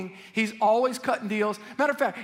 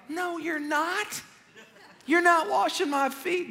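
Peter refusing; 7 semitones higher, slower than usual. Retold or imitated. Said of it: "Peter's like".

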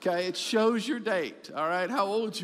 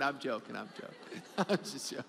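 fading into soft laughter; laughing it off; 8 semitones lower, 9 dB quieter than usual. Real, not acted. Pastor laughing off his camera joke to the church. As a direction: chuckle the words away.

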